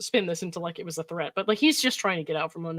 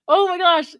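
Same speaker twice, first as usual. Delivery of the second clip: shocked; 10 semitones higher, 8 dB louder than usual. Real, not acted. Shocked reaction.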